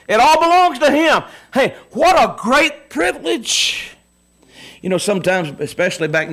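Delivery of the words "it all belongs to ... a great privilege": exultant preaching. Acted.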